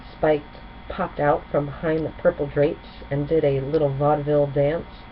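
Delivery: ordinary delivery, nothing marked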